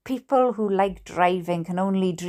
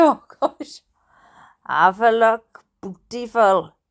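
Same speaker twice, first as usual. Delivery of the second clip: put-on Hampshire accent; 3 dB louder than usual. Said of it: "If I gotta speak Steve's language".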